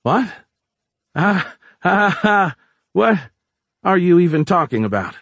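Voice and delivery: high, loud-ish nervous fake laugh and denial; rattled. A narrator's reading. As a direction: laugh it off, badly flustered. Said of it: "Giles rattled".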